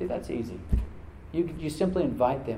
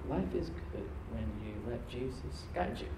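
quiet sincere offer; 2 semitones lower, 12 dB quieter than usual. Shown as a demonstration. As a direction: say it softly to one person. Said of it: "You can steer someone".

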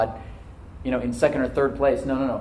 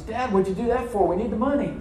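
put-on higher, startled young son's voice; a son protesting to his father, high and startled; son in shock; 8 semitones higher than usual. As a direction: voice the boy, shocked at his dad. Son voiced in preacher's story.

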